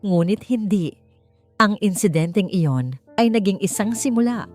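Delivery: ordinary delivery, nothing marked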